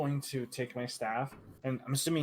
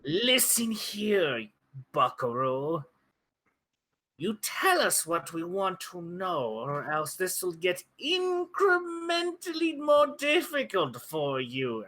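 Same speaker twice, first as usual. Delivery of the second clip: put-on slow, drawn-out old man voice, pitched up; hammed-up old-timer making a threat; threatening old man; 6 semitones higher, 4 dB louder, slower than usual. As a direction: threaten like an old codger, stretching the words. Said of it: "as an old man".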